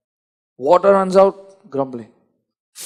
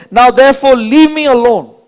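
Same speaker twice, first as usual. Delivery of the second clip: louder, higher stern command; God's angry command; 4 semitones higher, 6 dB louder than usual. Retold or imitated. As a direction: push Moses away, stern and loud. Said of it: "he says in verse ten".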